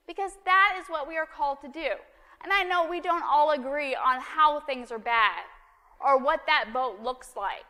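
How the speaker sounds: preacher rousing the congregation to act, loud and high; fervent preaching. Acted. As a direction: drive the message home from the pulpit. Preaching the point.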